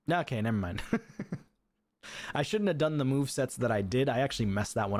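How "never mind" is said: ordinary talk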